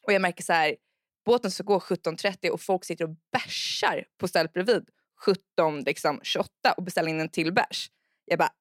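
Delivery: ordinary talk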